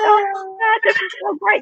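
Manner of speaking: high, gleeful mock excitement; gushing delight. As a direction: squeal it like a thrilled new mom. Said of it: "And I was like".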